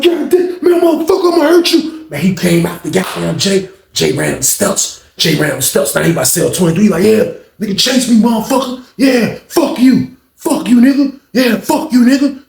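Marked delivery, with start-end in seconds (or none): 0.0-2.1 high, loud angry shout; furious threat. Retold or imitated. Kurt threatens attacker. 7.0-12.4 Jay jeering as he runs up the steps; taunting jeers. Retold or imitated. Jay taunts while fleeing.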